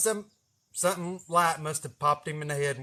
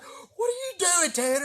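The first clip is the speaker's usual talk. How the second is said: much higher, slow incredulous wife. Retold or imitated. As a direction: demand what he's doing, baffled. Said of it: "She's like".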